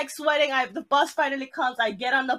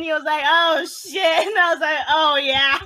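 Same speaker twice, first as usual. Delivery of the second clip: loud, higher, laughing through the words; laughing retelling; 5 semitones higher, 6 dB louder than usual. Real, not acted. Replying to friend's sympathy about breaking down, laughing hard. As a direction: crack up while recounting your meltdown.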